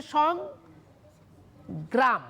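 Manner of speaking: সংগ্রাম drawn out with a long break after সং; split into syllables. Shown as a demonstration. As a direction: say সং, hold a pause, then gram. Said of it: "দুভাবে লিখতে পারো".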